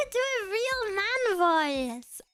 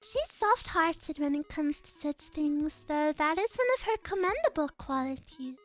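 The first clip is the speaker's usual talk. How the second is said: lower, measured and calm; composed noble girl; 6 semitones lower than usual. Acted. Reads a game character's lines.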